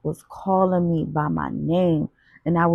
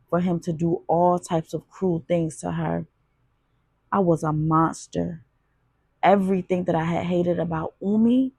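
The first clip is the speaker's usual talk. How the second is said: ordinary delivery, nothing marked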